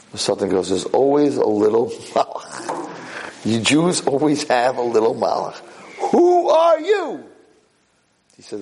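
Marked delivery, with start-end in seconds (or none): 0.7-5.6 grumbling, put-on voice of complaint; exasperated Satan. Satan complains about the little angel. 6.1-7.2 very high, drawn-out shout; outraged challenge. Acted. Satan challenges the little angel.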